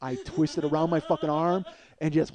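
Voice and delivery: ordinary delivery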